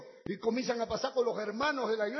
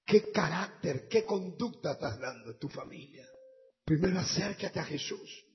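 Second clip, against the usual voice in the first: lower, intimate invitation over music; altar call; 3 semitones lower than usual. Acted. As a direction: call them forward tenderly. Llamado al altar con música. (llamado al altar, íntimo y suplicante)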